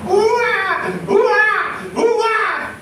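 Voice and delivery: high mock baby crying ua ua; imitated baby wail. Preacher imitates a feverish baby crying high. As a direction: wail like a sick infant, pitched high. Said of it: "¿qué hacen ellos?".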